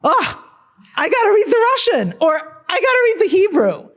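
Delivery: high, quick, eager voice of a thrilled reader; an excited reader, voiced high and fast. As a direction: gush like a reader who just discovered the original. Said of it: "you get a reader who says".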